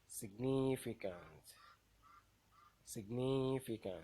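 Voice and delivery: Significant with NIF loud and long; teacher's demo of sig-NIF-icant; stressed syllable. Shown as a demonstration. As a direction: slowly punch NIF in Significant. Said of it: "the one that will be pronounced louder".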